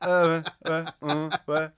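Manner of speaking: "ouais" as a loud, slack "weh"; bored Parisian "ouais". Acted. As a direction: grunt "ouais" with the mouth hanging open. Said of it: "I can barely articulate the sound".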